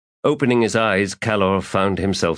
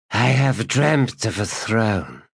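undead dragon voice; 4 semitones higher than usual. Acted.

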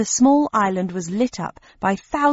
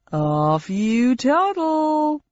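slow, higher showy announcer; game show host voice; 5 semitones higher, much slower than usual. Acted. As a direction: introduce the round with TV flair. Host sends her off.

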